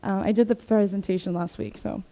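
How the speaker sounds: ordinary talk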